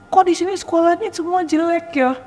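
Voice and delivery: retold child's high-pitched whine; mock tearful complaint. Rich family's child complaining about the school, mock tearful. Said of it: "Dia nangis, dia bilang".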